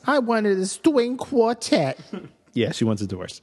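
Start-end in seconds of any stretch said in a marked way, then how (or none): 0.1-2.0 high, slow mock clergyman; Princess Bride priest. Voicing Gina's wedding complaint, high mock priest voice. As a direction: stretch it out in a pompous impeded voice. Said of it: "Is that still the priest from The Princess Bride?".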